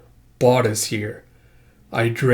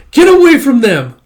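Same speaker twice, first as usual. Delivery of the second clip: high, loud, frantic shout; protective yell; 13 semitones higher, 11 dB louder than usual. Acted. Character defending knocked-out companions, frantic yell. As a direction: scream to drive attackers off.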